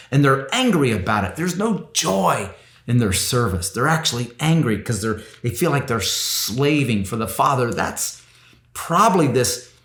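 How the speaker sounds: ordinary delivery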